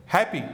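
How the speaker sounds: "हैप्पी" with open strong ae; "हैप्पी" wide ae vowel. Shown as a demonstration. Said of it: "जो stronger ए है". ("हैप्पी" में खुला ऐ)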